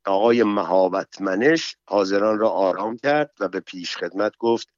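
ordinary delivery

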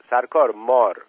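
ordinary delivery

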